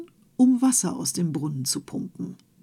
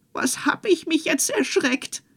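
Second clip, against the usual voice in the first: high, startled wife's voice; a wife shaken by a sudden shock; startled cry; 9 semitones higher, 3 dB louder than usual. Acted. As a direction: gasp it out in a fright. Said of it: "rief die Frau".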